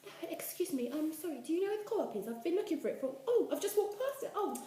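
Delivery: high, sing-song posh-English politeness; best English accent. Pretend. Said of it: "Put on your best English accent".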